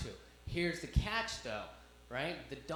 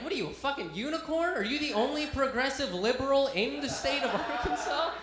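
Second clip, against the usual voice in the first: high, loud burst of amazement; rapid-fire comic disbelief, pitched up; incredulous excitement; 8 semitones higher, 5 dB louder than usual. Retold or imitated. Comic tells a progressive Arkansas trooper he's a unicorn, high excited disbelief.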